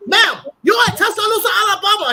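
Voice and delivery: high, loud imitated shout of "Tuscaloosa, Alabama"; mock shout. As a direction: belt it out like the waiter yelling. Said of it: "He said".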